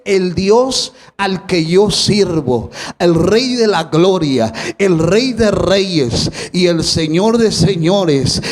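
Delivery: preacher mimicking a boastful believer; proud declaration. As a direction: proclaim each title slowly like a boast of faith. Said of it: "sabes qué es lo primero que dice".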